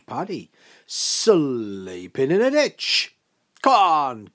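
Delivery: slow, loud, stretched-out exclamation; big hearty beckoning; hearty disbelief. Acted. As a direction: boom it out to an old friend. Big man hails his friend.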